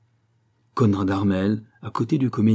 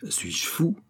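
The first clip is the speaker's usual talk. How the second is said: murmure inquiet, sans voix; chuchoté; 7 semitones higher, 5 dB quieter than usual. Acted. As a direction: se parler tout bas, effaré. Fonty doubts his own sanity. (un homme se demande s'il est fou, chuchoté)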